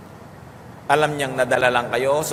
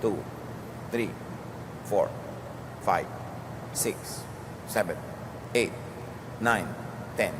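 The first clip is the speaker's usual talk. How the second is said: slow counting to ten; 5 semitones lower, 11 dB quieter, slower than usual. Shown as a demonstration. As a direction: count slowly, a pause after each number. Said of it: "sabihin mo muna". (mabagal na pagbilang)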